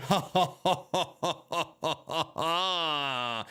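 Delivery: low, soft imitation of an evil laugh; villain laugh. Streamer copying a wrestler's villain laugh, low mock cackle. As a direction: cackle like a wrestling heel, lightly. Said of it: "He then laughed like a villain".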